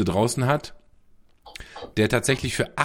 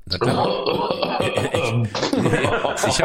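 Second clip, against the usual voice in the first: laughing; much slower than usual. Real, not acted. Laughs at confusing question.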